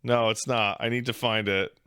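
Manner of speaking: ordinary talk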